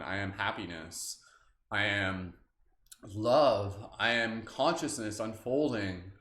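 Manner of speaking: slow, calm affirmations, each one set apart; slow affirmation reading. Acted. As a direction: let each "I am" sink in slowly. Affirmations continue.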